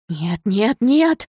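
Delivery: rising to a high, loud cry; desperate denial. A narrator's reading. Girl refuses news of the death.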